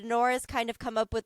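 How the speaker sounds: normal talking voice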